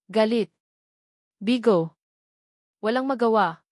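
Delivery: ordinary delivery, nothing marked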